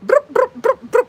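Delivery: loud rising brrr of a power tool; imitated drill buzz. Telling viewers how the helper changed the tire, loud buzzing. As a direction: buzz like a torque gun, up high.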